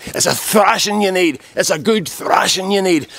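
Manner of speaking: high-pitched mock-angry threat; acted threatening rant. Attacker threatening him with a thrashing, mock-angry rant. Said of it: "Next thing I started again".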